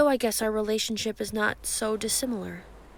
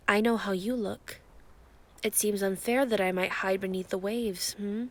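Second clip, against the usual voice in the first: ordinary talk, nothing marked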